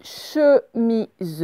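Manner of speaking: slowly separated syllables, final one voiced. Shown as a demonstration. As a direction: sound it out for pupils. Correction, découpe le mot en syllabes.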